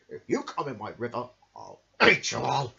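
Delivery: growled threat, loud at the end; crocodile threat. Acted. Selfish crocodile threatening the creatures, menacing. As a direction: threaten them with a snap at the end. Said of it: "he shouted to the creatures".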